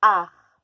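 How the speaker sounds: art dit très fort; mot appuyé. Shown as a demonstration. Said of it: "quels sont les sons". (mot crié)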